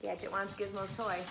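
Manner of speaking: ordinary delivery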